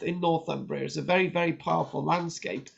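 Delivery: usual speaking voice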